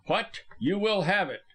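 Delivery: moderately angry exclamation; angry cry. A narrator's reading. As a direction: burst out as a threat. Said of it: "cried Ellis angrily".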